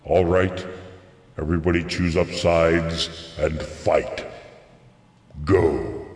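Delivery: low, slow booming overlord command. Retold or imitated. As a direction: boom it like an arrogant alien overlord. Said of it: "some big booming voice saying".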